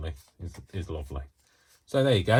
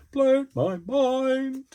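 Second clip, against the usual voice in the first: much higher and very slow, stretched out; squealed repeat; 6 dB louder, much slower than usual. Shown as a demonstration. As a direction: squeal "Blown my mind" high and drawn out, marvelling.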